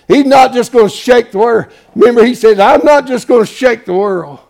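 loud, ringing pulpit voice; rising revival preacher; full-force preaching. Acted. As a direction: preach it out to the congregation. Preacher building to loud climax.

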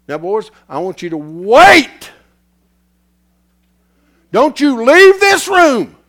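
slow, loud order to stay put; Jesus forbidding the boys to leave, loud and slow; commanding Jesus. Retold or imitated. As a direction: hold them in the room with a firm command. Jesus voiced commanding disciples.

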